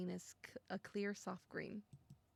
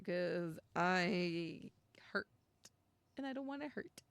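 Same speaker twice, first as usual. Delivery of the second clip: normal talking voice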